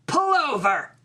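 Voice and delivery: sharp, high cry to stop the car; desperate command mid-labour; urgent shout. A narrator's reading. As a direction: yell at the driver to pull over.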